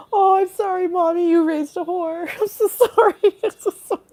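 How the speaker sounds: high, giggly mock wail; laughing apology. Comedian apologizing to Mommy, high laughing mock wail. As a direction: plead through giggles, high-pitched.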